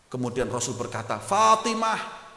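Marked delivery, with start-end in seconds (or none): 1.1-2.3 slow, drawn-out, high loud call. Acted. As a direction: call her name across the room, stretched. Prophet calls his daughter. (memanggil dengan suara panjang dan keras)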